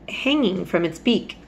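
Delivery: ordinary delivery, nothing marked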